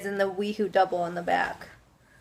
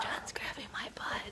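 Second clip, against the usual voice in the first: whispering; 14 dB quieter than usual. Real, not acted. Aside to viewers while husband gropes.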